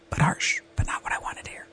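unvoiced whisper, dropping the voice; whispered aside. Real, not acted. Podcaster whispering an aside to her listeners, hushed. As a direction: breathe it like a confession. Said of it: "whispered aside".

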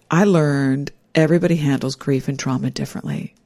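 ordinary talk, nothing marked

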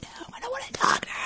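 hushed, fast mock whisper; imitating someone secretly begging off; whispered aside. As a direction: whisper a refusal behind the receiver. Imagined Cookie whispering aside to colleague.

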